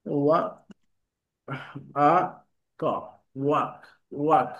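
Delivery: ওয়া, আ, ক split into sounds, then ওয়ার্ক blended; sounding it out. Shown as a demonstration. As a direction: break ওয়ার্ক into its sounds slowly. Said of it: "দেখেন এখানে দেওয়া আছে".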